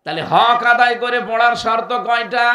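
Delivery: loud, high call to the audience; rousing question to crowd. Real, not acted. Preacher quizzing the gathering, loud rousing question. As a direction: demand the answer from the crowd.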